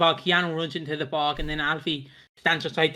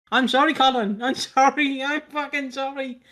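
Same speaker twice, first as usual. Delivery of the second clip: higher, pleading mock fear; mock scared apology; 6 semitones higher than usual. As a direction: playfully beg forgiveness like you're terrified. Acting out being scared shitless.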